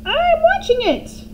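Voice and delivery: high, loud crone reply; grandma shouting down to George; Grandma shouting back. A narrator's reading. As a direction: bark it back like an old hag.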